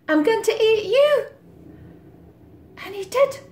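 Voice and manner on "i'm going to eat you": high, loud, fast gobbling threat; menacing cat. Acted. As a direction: pounce on the line like a greedy cat.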